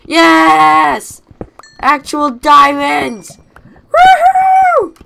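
high, very loud celebration; jubilant shout. Real, not acted. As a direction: whoop in triumph, stretching the words. Finally finds real diamonds.

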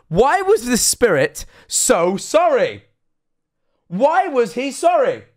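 high, loud, incredulous questioning; stretched-out, high-pitched alarm; panicked rant. Real, not acted. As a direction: wail each question in mounting panic. Ranting in mock panic about ghost.

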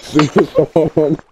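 slow, stretched-out shout; insistent drawn-out chant, shouted; loud demand. Real, not acted. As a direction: yell it high, dragging out the words. Repeats the demand louder.

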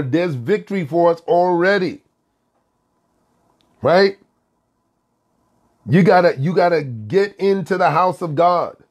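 rally them like a pastor from the pulpit; preaching. Acted. Pastor exhorts listeners to church.

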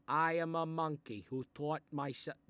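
normal talking voice

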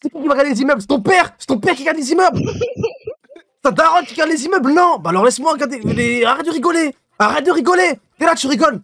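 high, loud hammed-up scolding; loud mock outrage at being laughed at; mock furious rant. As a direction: rant as if deeply offended, over the top.

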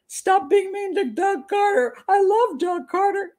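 high, slow, pleading fan voice; mock-pleading Doug Carter supporter; imitating a fan. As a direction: mimic a gushing fan begging you to be nice. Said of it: "I've had people say".

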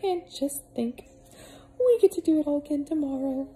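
high put-on motherly voice; cheery housekeeper. Missus Molasses sending Peter to bed, high cheery voice. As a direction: shoo him off to bed brightly.

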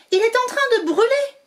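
high panicked exclamation. Acted. Witch panicking over her burning broom, high alarmed voice. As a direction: cry out that it's burning.

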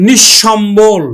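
slow নিঃসম্বল as nish-shombol; doubled palatal sh. Shown as a demonstration. Teacher introducing the next example, slow nish-shombol in নিঃসম্বল. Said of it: "তালব্য স উচ্চারণ করি".

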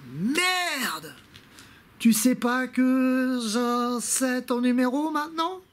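high, drawn-out taunting cry; mock exclamation. Vlogger taunting a prank caller about the number, high drawn-out mockery. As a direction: stretch it out high, mocking the caller.

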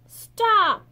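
a high, loud yell. A narrator's reading. Fisherman chasing Mei Mei, yelling high and loud. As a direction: bark the order after her. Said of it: "he yelled at Mei Mei".

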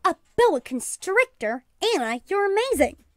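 high, amazed gushing; excited. A narrator's reading. Ladies marvel at their friend's pet snake, excitedly. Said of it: "the ladies' excited comments".

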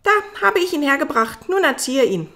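high, loud, breezy puppet voice; offhand Buratino. Acted. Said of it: "sagte leichthin".

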